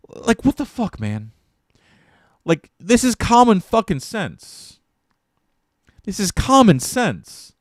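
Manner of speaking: high-pitched, drawn-out exasperation; exasperated rant. Real, not acted. Streamer ranting at AI that lacks common sense, exasperated.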